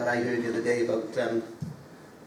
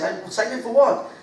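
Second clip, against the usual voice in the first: exasperated question; 10 semitones higher, 4 dB louder than usual. Retold or imitated. Mandela exasperated.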